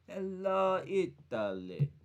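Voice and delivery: a lightly voiced Italian hello, Italy trailing off slow; hint of an Italian accent on the greeting; Italian impression. Shown as a demonstration. Greeting Italian viewers in a mock Italian accent. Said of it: "That's my impression".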